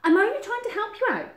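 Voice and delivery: high, loud self-justifying badger; pushy Badger protesting; butting in. A narrator's reading. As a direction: cut in loudly, pitched right up. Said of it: "Badger butted in".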